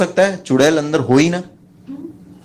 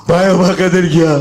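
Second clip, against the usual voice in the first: slow, loud, distorted mock wail; 2 semitones higher, 4 dB louder than usual. Friend telling the narrator Aba Kadar is gone, mock wail. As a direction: wail out the bad news.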